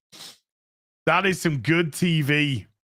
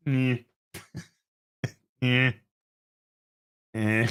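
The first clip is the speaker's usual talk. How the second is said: ordinary delivery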